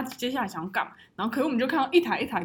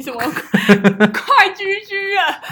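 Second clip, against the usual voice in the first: laughing; 7 semitones higher, 8 dB louder, much slower than usual. Real, not acted. Laughing at own words.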